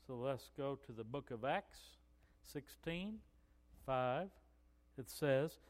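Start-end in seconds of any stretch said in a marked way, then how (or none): none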